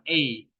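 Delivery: "E" held long, like the vowel in air. Shown as a demonstration. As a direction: stretch out the "E". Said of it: "karena E-nya E panjang".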